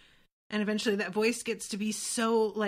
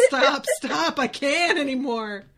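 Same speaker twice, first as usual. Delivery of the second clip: mock-agonised plea, raised and loud; imitated husband crying out through amusement; pleading; 4 semitones higher, 7 dB louder than usual. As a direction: beg loudly for it to stop. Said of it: "he's just going".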